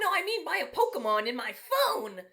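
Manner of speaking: wife clarifying in a mock squeaky voice; mock high wife voice. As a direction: imitate the wife in a silly high pitch. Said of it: "What the fuck?".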